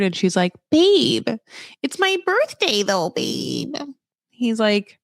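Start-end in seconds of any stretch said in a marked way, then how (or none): 0.7-3.8 high mock-pleading girlfriend, dropping at the end; whiny protesting girlfriend. Girlfriend dropped off on her birthday, high mock whine.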